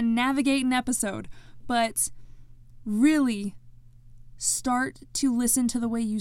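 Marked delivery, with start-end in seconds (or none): none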